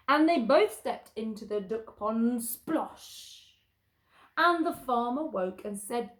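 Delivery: loud, lively read-aloud climax; bouncy picture-book reader; animated story narration. A narrator's reading. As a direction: tell the comic climax with gusto.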